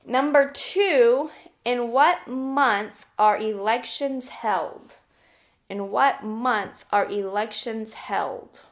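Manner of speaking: slow, clear dictation of a question; slow teacher dictation. Shown as a demonstration. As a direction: spell it out slowly for the class to write down. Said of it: "I'll give you a hint".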